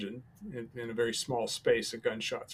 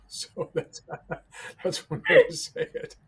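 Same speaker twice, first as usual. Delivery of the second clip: laughing; 5 dB louder than usual. Real, not acted. Laughs at own joke.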